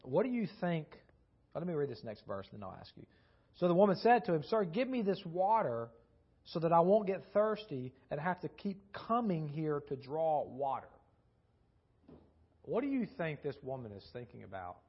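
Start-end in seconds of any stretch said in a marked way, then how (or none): 3.5-10.9 louder, measured Bible reading; scripture reading. Acted. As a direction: read the passage to the congregation.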